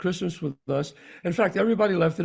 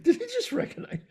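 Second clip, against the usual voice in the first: much higher, astonished question; daughter's surprise. Retold or imitated. Quoting the daughter after the priest nods, high astonished question. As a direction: squeak it out in disbelief. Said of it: "my daughter looks at me like".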